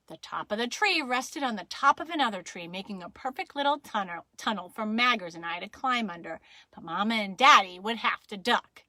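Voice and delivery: usual speaking voice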